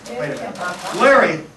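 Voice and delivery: ordinary talk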